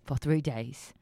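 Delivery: slow mock Michael Caine accent fading to a whisper; slow, drawn-out mock Michael Caine accent; Michael Caine impression. Host muses in a mock Michael Caine accent. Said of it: "let's talk like Michael Caine".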